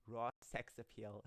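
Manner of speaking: ordinary delivery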